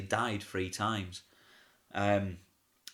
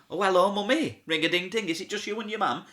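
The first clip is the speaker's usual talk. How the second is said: playful panto greeting; 8 semitones higher, 4 dB louder than usual. Retold or imitated. Comic greeting a mum on stage.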